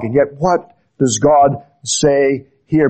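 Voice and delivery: usual speaking voice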